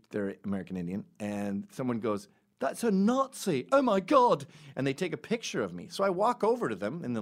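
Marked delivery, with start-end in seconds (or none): 2.6-4.5 high, loud, slow shocked gasp of accusation; imitating a horrified stranger; mock alarm. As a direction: play the shocked bystander. Stranger at airport spots him.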